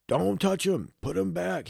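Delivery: high, put-on fussy voice; retelling the fussy grandpa he didn't have, in a high mock voice; mock strict grown-up. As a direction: squeak out a nagging warning. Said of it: "one of those people who was like".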